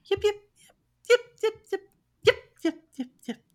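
short high-pitched yips; quick high animal yipping; coyote yips. Acted. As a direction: yip like a small pack of coyotes. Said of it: "you hear three yips you didn't hear before".

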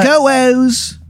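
squeaky-high Baltimore character voice; bad Baltimore accent. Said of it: "this Baltimore accent".

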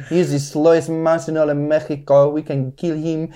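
cartoon cats in a Mexican accent; mock-Mexican cartoon villain. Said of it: "and they're like".

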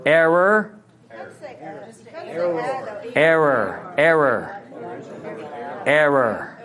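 Error drawn out as "err-or" with a Missouri r; Missouri accent. Shown as a demonstration. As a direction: repeat "Error" the Missouri way, rolling the r. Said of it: "saying it in my Missouri accent".

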